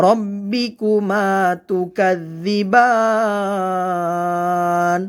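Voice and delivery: slow chant, "বান" at the end held very long; three-alif elongation. Shown as a demonstration. Teacher showing learners "তুকাদ্দিবান" with a long-held ending. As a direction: stretch the last vowel of "তুকাদ্দিবান" for three beats. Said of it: "তিন আলিফ পরিমাণ টেনে পড়তে হবে".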